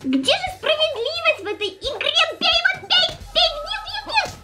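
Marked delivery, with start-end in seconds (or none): 2.4-4.3 high laughing urging; excited urging. Real, not acted. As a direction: egg it on laughing.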